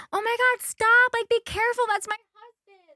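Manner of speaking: very high, mock-panicked woman; drunk wife pleading. Drunk woman as her husband is escorted out, mock panic. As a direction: imitate a frantic wife in a high voice. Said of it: "the woman's just like".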